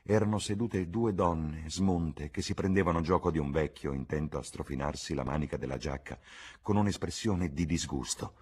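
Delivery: plain reading voice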